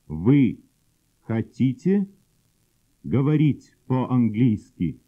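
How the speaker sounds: ordinary delivery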